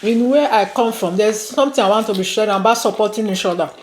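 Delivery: ordinary delivery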